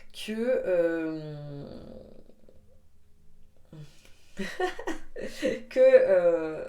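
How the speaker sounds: laughing out loud. Real, not acted. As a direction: breaks into laughter. Laughing mid-sentence.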